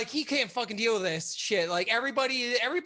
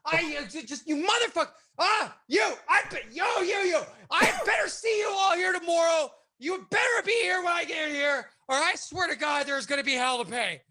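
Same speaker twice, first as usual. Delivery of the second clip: imitating a furious screaming exit; 10 semitones higher than usual. Boss screaming at his staff as he storms home, furious. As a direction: scream threats while storming off.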